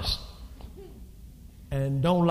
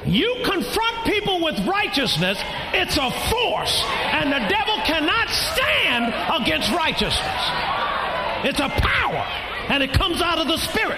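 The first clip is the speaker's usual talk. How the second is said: preaching shout; 9 semitones higher than usual. Acted. Preacher shouting that righteousness is a force. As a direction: hammer it home like a revival.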